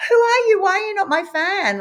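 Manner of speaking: high, loud indignant cry; incredulous outburst. Retold or imitated. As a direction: demand it, stung and disbelieving. Retold outburst at husband.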